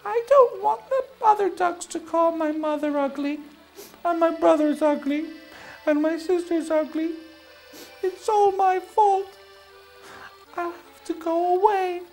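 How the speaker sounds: high, plaintive little duckling voice; hammed-up weepy, high-pitched self-blame; tearful duckling. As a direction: whimper it as a heartbroken baby duck. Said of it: "he started to cry".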